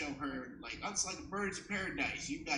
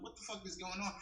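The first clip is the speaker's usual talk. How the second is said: higher, softer bewildered mock question; confused; 5 semitones higher, 6 dB quieter than usual. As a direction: imitate your own bafflement under your breath. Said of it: "I'm, like, very confused".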